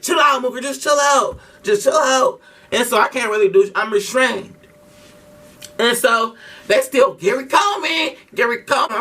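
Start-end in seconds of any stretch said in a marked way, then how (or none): 0.0-2.4 urgent restraining plea. Retold or imitated. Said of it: "sister has me pinned". 7.1-9.0 mock taunting. Kids taunting again.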